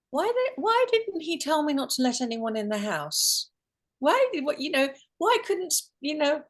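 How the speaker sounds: high-pitched, retold exasperation; high, emphatic wondering aloud; exasperated questioning. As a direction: act out asking why, pitched well up. Acts out questioning thoughts in intensive care.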